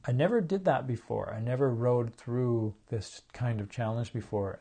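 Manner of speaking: ordinary delivery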